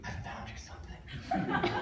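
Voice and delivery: breathy unvoiced whisper, slowed down; dad whispering a find to his son. Dad whispers on the hunt, hushed and slow. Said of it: "he whispers".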